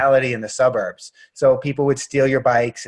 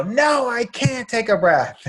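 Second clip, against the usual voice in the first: put-on high, slow whiny child protest; mock daughter whining she can't breathe; upset little girl; 10 semitones higher, slower than usual. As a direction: play the five-year-old refusing. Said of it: "And she'll go".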